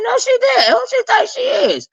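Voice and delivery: squealed way up high in disbelief; mock outrage in a squeaky high voice; high-pitched shocked reaction. Vlogger reacting to the tweet, high squeal of disbelief.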